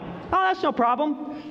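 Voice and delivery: high, slow, falsely breezy voice; patient faking calm before the doctor; mock bravado. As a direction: pretend it's nothing, with a laugh. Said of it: "I'm like".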